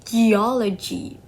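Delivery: "geology" said slowly with the stress on "o"; stress before -logy. Shown as a demonstration. Explaining the logy suffix, "geology" slowly stressed on the "o". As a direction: stretch out "geology", leaning on the "o". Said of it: "causes the stress to move to the syllable".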